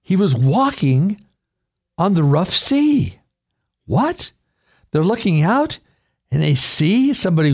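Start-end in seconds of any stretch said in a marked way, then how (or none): 3.9-4.3 sharp high astonished what; amazed. Acted. Disciples seeing a figure on the sea, astonished. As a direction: gasp it out in disbelief.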